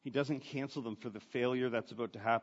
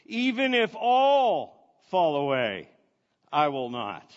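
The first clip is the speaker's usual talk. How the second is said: bold declaration; 9 dB louder than usual. Retold or imitated. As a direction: plant your feet and proclaim it. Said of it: "Peter declared".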